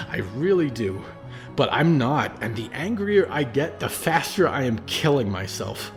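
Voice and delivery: put-on a raging boy begging to be calmer; anguished anger. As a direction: spit out the helplessness. Percy's anguished angry outburst.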